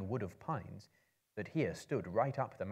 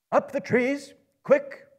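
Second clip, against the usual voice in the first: much higher, louder shouted command; urgent cry; 15 dB louder, slower than usual. A narrator's reading. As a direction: yell the order to climb. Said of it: "cried Gandalf".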